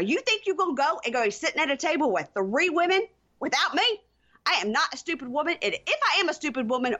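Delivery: high-pitched, indignant berating; heated rant, pitch kept high throughout; angry tirade. Acted. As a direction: act out an offended fiancée demanding answers. Acted tirade at her fiancé.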